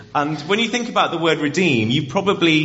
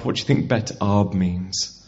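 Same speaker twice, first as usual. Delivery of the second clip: ordinary delivery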